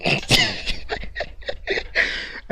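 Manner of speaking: laugh. Real, not acted. Laughing at shooting stats.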